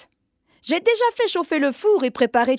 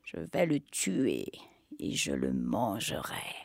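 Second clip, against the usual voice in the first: much lower, soft growling witch; sinister deep threat; 14 dB quieter than usual. Acted. Witch vows to kill.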